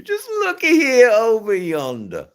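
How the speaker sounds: high, laughing mock Southern drawl; playful Southern American imitation; Southern accent. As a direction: put on a big Southern drawl. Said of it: "Ray Gregory".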